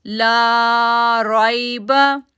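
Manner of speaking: "ला राइबा" slow with "ला" stretched; long laa. Shown as a demonstration. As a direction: hold the "ला" long. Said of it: "ला को खींचेंगे". ("ला" को लंबा खींचो)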